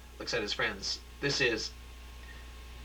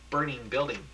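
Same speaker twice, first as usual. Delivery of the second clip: ordinary delivery, nothing marked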